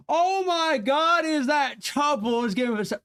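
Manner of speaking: sudden thrilled outburst on seeing a friend; high squealing excitement; excited shriek. Real, not acted.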